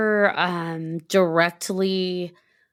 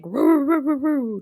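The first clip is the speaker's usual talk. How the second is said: dog barking imitation; 8 semitones higher than usual. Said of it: "and is like".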